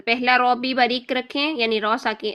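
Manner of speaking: ordinary delivery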